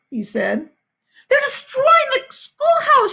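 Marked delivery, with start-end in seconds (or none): 1.3-3.1 high, panting, desperate; breathless panic. A narrator's reading. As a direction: gasp it out in a rush. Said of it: "gasping for breath".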